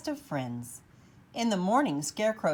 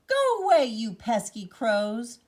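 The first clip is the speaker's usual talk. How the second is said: high, loud shout, slowing down; 4 semitones higher, 7 dB louder than usual. A narrator's reading. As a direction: yell to scare off the crows.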